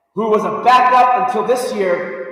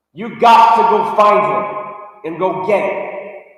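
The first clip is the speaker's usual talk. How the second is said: ordinary talk, nothing marked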